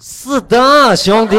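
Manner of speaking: high, loud puppyish yelp. Acted. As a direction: yap it out like an eager puppy. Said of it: "不要再学小奶狗了啊".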